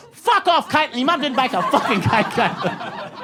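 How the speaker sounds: high-pitched outburst, dropping off at the end; hammed-up childish disbelief; mock indignation. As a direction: fire back like an outraged kid. Quarrel replayed, escalating child outrage.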